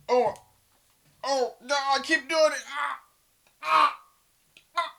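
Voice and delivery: panicked shouts after touching his face; real startled panic. Real, not acted. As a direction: yelp in real alarm. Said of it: "I thought I was getting the Rona".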